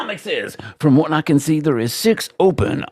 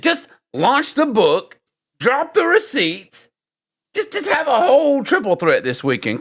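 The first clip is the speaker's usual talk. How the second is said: mock hype; 14 semitones higher than usual. Real, not acted. Urging launch with mock hype.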